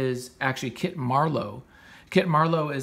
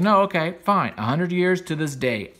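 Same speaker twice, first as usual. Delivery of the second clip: higher, louder half-mocking agreement; tossing off a taunting fine, louder and higher; 6 semitones higher, 7 dB louder than usual. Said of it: "He's like".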